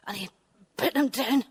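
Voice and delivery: ordinary delivery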